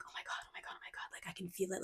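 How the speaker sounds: breathless awed whisper, almost no voice; excited whisper. Retold or imitated. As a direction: whisper it like a thrilled child. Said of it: "Your little one is like".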